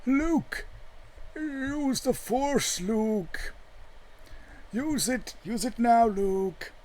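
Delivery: high, far-off calling voice, drawn out; mock Jedi call. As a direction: call to Luke like a spectral mentor, high-pitched. Calling Luke like a Jedi ghost.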